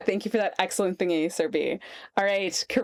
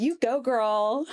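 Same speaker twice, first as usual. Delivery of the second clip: mock American cheer; 5 semitones higher, slower than usual. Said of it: "mocking the cheerful American voice".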